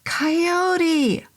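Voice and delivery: long high scream of the name; desperate high scream. Acted. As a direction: scream it up at the demon. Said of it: "I screamed".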